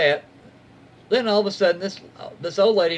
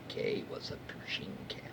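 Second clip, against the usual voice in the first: whispered aside; 17 dB quieter than usual. Real, not acted. Aside to self while reading.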